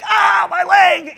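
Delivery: very high, drawn-out comic wail; loud, hammed-up howl of pain; pained yell. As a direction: cry out as if your leg is being crushed. Voices Balaam's crushed leg.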